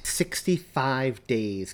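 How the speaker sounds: drawn out slowly, each word weighed; slow down and let the number land; slow emphatic repeat. Acted. Host repeating the countdown to Christmas for listeners, slow and emphatic.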